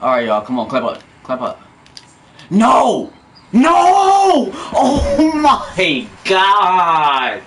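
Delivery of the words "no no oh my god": 2 loud high no, then a long laughing oh my God; 3 howl in disbelief; 4 gamer's laughing shout of disbelief; 1 real shout. Real, not acted. Disaster moment, laughing shout.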